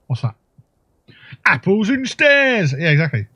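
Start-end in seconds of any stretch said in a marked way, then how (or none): 1.4-2.7 high, slow Cockney caricature; mock Cockney voice. Player offering rhyming slang, high drawn-out mock Cockney. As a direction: stretch it out in cartoon Cockney. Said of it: "Obviously, I enjoy that voice".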